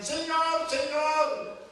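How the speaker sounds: high-pitched mock appeal to the Lord; pleading crowd at judgment. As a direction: cry it out high, as people pleading their case. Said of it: "muchos me dirán".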